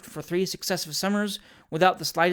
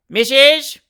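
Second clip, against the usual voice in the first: loud, high threatening shout; agitated shout; 8 semitones higher, 12 dB louder than usual. A narrator's reading. Fat boy shouting in old lady's ear, agitated. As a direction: shout it urgently into a deaf ear. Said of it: "shouting in her ear in an agitated and, as it seemed to her, a threatening tone".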